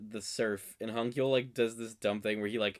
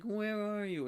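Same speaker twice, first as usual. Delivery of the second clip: high, anxious question into the phone; higher pleading where-are-you; mock worried call; 10 semitones higher than usual. Girlfriend on the phone asking where he is, high and anxious. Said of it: "And she's like".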